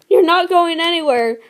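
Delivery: mate crying out in denial; loud desperate denial. Acted. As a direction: refuse to let her go. Mate refuses her dying.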